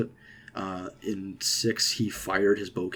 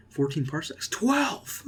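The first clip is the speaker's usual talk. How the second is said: imitating Han; 8 semitones higher, slower than usual. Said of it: "it's like, it's like".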